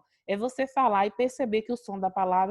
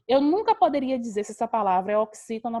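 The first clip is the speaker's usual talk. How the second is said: ordinary delivery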